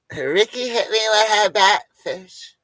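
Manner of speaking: high, loud tattling voice; mock-complain like a hurt friend; imitating Nicole tattling; whiny complaining woman. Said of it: "voicing Nicole complaining".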